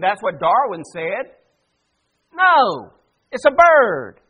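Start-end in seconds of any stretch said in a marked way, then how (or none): 2.3-4.2 emphatic correction. Acted. Correcting his own joke.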